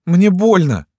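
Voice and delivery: high, loud pained whine; whimpering girl. A narrator's reading. Wounded Lyuska tells doctor it hurts, whimpering. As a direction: wail it in a girlish pitch. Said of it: "захныкала Люська".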